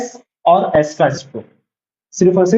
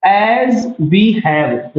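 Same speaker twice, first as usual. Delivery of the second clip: as we have, each word very slow and drawn out; teacher dictating as we have word by word; slow dictation; 2 semitones higher, much slower than usual. Shown as a demonstration. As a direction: read the phrase as we have out slowly for writing. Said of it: "देखो word है phrases".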